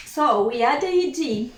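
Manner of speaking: ordinary talk, nothing marked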